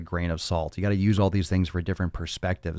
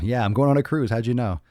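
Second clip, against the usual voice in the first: higher, louder put-on client; mock pleased admission; imagined cruise-goer reply; 5 semitones higher, 5 dB louder than usual. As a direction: answer eagerly as the teased client. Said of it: "I want you to say right now".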